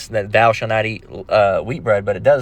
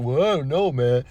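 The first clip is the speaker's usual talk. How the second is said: mocking borrowed voice; 2 semitones higher, 4 dB quieter than usual. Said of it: "that's how they sound to you when they talk".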